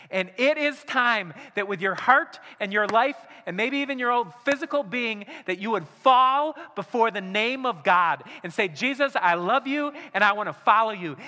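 loud, high, impassioned altar-call preaching; fiery sermon climax, voice ringing out; preaching at full force. Acted. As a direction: drive it home to the congregation.

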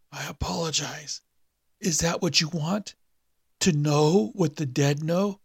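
high, slow rasp sinking into a whisper; a corpse croaking up from the abyss; the awakened dead man's dry rasp; dry raspy dead man. A narrator's reading.

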